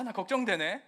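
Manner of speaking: usual speaking voice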